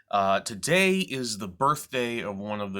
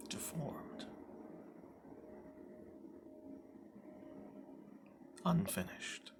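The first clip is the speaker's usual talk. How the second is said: whisper; 7 semitones higher, 15 dB quieter than usual. Acted. Hushed self-loathing in soliloquy.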